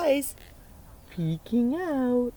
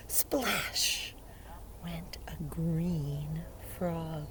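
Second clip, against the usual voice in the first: storytime whisper; 4 semitones lower, 6 dB quieter, much slower than usual. A narrator's reading. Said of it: "It says".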